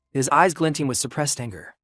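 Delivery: plain reading voice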